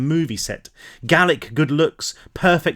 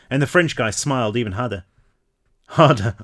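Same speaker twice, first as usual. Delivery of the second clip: slipping into French; 2 semitones lower than usual. Real, not acted. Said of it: "I'm speaking French without meaning to".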